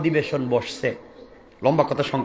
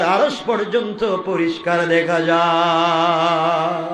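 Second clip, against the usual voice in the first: intoned sermon, rising high then settling; melodic preaching; 5 semitones higher, slower than usual. A narrator's reading. Preacher telling of Allah's mercy answering tears, melodic chanting. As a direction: chant the story in waves, stretching endings.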